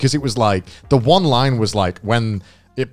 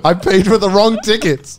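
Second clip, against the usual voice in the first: high wail through laughter; laughing lament; 6 semitones higher, 3 dB louder than usual. Real, not acted. Friend confessing he bought wrong cinema tickets, laughing wail. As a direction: crack up while confessing the blunder.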